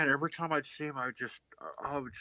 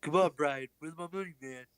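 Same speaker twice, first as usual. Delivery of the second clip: Stewie impression; 6 semitones higher than usual. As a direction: lean on him for money. Stewie demanding money.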